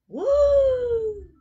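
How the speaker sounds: loud, high, long "woo"; whoop. Real, not acted. Player celebrates rank in game, loud high whoop. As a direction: let out a big high whoop. Celebrates making the top twenty-five.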